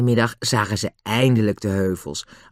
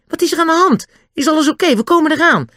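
high, loud urgent call; alarmed calling; 6 dB louder, faster than usual. A narrator's reading. Two boys call to their friends at night, alarmed calling. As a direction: call out that help is coming.

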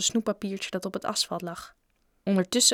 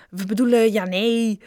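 loud, muddled backtracking; flustered correction; 7 dB louder than usual. A narrator's reading. The larvae backtracking in front of Bob, flustered. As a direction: blurt out a hasty correction. Twins fumble their correction.

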